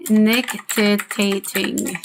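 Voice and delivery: "Nictitating" drawn out very slowly, syllable by syllable; slow, broken-up reading of "Nictitating"; sounding out a word. Shown as a demonstration. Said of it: "I don't know how to say that word".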